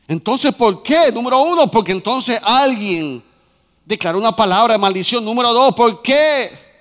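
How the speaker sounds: loud sermon points, hammered out; preaching at full force. Acted. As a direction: call the points out to the church. Predica con fuerza. (predicación fuerte, enumerando a gritos)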